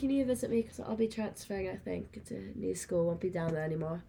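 put-on British accent. Retelling his text about transferring, British accent. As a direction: quote his text as a Brit. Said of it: "I need the British accent for it".

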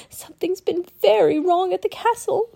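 put-on high child voice, warning; worried village girl. A narrator's reading. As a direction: warn them as an anxious girl. Village girl warns of danger.

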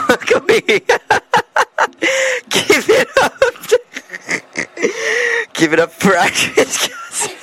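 high, squealing real laughter through words; cracking up. Real, not acted. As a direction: laugh helplessly while trying to announce. Host cracks up introducing the guest.

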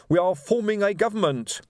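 high, self-important declaration; proud. A narrator's reading. Revolutionary telling sergeant of their government, proud. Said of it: "said Reg proudly".

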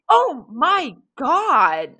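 loud, high, drawn-out horror; shocked exclamation. Acted. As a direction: exclaim slowly at an awful injury. Betty reacts to broken arm.

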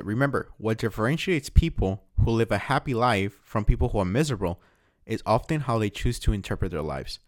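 English quote read from a book; reading aloud. Acted. Podcaster reading a self-help quote to listeners, read aloud.

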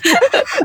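a squeaky rising giggle; high giggle. Real, not acted. Host giggles after quoting her own humble reply, high giggle.

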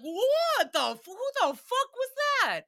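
high, loud startled yell; startled shout. Retold or imitated. Startled by a voice on the mic, yelling out in shock. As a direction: jump out of your skin, yelling. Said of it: "and I was like".

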